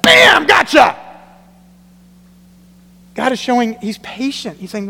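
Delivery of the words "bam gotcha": loud, high mock gotcha; spring the trap with a shout; gleeful gotcha shout. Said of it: "he's like".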